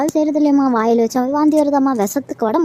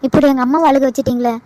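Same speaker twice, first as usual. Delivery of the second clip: normal talking voice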